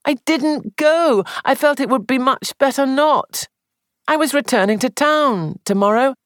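rising into agitation; high and excited. Acted. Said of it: "Sandford's voice rose high and excited".

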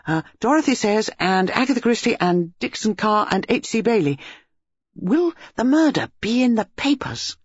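high, chattering schoolboy; a keen young crime fan; eager boy. A narrator's reading. Boy quizzing the detectives, high and eager.

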